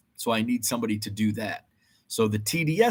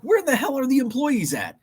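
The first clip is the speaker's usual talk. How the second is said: high whining complaint; high mock frustration; mock exasperated shopper; 14 semitones higher than usual. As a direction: whine like a lost customer. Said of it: "you're like".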